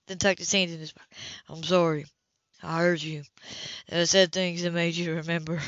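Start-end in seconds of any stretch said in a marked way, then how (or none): 1.5-5.6 lower, owning the hurt; Cam, low and apologetic. A narrator's reading. Cam apologizing to Hannah for stirring memories, lightly voiced, lower.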